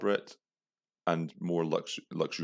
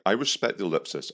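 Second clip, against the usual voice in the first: ordinary delivery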